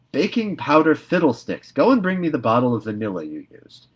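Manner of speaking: loud, impatient snap; brusque scolding. A narrator's reading. Marilla dismissing Anne's excuse, loud and impatient. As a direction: cut her off sharply.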